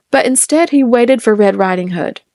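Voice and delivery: ordinary delivery, nothing marked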